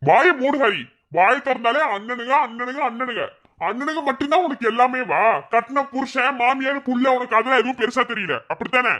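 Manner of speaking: a husband finally losing his temper at his wife; angry outburst. Acted. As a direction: snap at her to shut up. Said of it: "கோவமா பண்றீங்க?".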